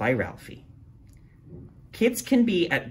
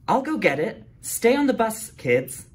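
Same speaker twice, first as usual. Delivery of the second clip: teacher's voice; 10 semitones higher, 4 dB louder than usual. Acted.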